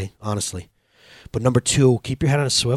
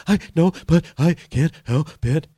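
halting, hitching mock sobs; imitated palpitation cry; 3 semitones higher than usual. Dad imitates crying son answering him, juddering mock cry. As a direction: gasp out each word like a crying child. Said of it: "palpitation cry".

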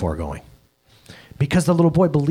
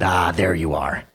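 gentle fatherly God; 5 semitones lower than usual. Retold or imitated. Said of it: "And he said".

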